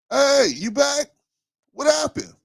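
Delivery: imitating his dad. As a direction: play the father calling out from the couch, way up high. Voicing his dad in story.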